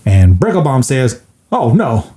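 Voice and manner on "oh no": touched neighbour. Retold or imitated. S0 voices Bricklebaum moved.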